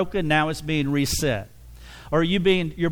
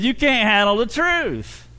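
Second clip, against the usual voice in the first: higher, pushed delivery of the famous line; a light hint of Jack Nicholson; 6 semitones higher than usual. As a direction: quote it like the movie. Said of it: "as Jack Nicholson said".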